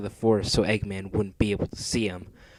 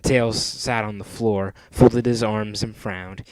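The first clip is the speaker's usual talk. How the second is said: plain reading voice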